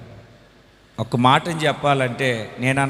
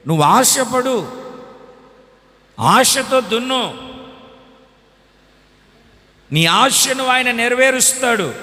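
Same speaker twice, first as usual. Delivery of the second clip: full-force preaching; 7 semitones higher than usual. Acted. Preacher promising the congregation hope, fervent shouting. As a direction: proclaim it to the congregation with fervour.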